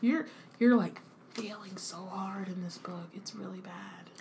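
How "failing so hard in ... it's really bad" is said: hushed, disapproving whisper; whispered aside. Real, not acted. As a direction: whisper it under your breath, very softly. Hushed criticism of Ron.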